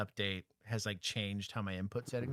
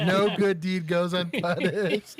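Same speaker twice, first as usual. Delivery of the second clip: mock dramatic; 7 semitones higher, 9 dB louder, slower than usual. Mock lament.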